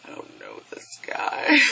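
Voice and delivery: hushed embarrassed whisper; mock whisper about an embarrassing friend; whispered aside. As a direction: breathe it like a secret aside. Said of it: "sometimes I'm just like".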